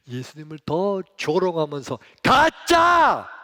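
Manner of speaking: 가짜 yelled loud with a falling end; shouted climax. Acted. Preacher shouting the crowd's taunt 가짜 at Jesus. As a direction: build and hit 가짜 hard and loud.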